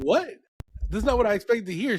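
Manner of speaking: high, fast what, rising in disbelief; shocked disbelief. Retold or imitated. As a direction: blurt it out, caught off guard. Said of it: "And I was like".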